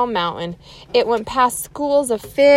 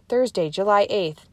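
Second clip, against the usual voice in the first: plain reading voice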